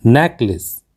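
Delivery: नेकलेस said as "NEK-lis"; correct pronunciation. Shown as a demonstration. Teacher gives learners the correct word, carefully pronounced. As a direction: say नेकलेस with short, open first e. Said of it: "इसका सही उच्चारण होता है".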